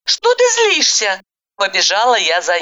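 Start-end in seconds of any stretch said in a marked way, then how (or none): 0.0-1.2 high, questioning woman's voice; voiced heroine. A narrator's reading. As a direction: ask in a higher female voice. Heroine's lines. (высокий женский голос)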